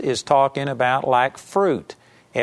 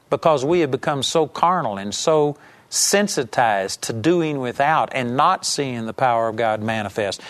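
ordinary delivery

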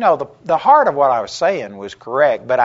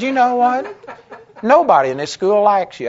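much higher, louder, half laughing; retold angry retort; 9 semitones higher, 6 dB louder than usual. Preacher tells the critic nobody likes him, high, loud, half laughing. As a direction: let him have it with a laugh in it.

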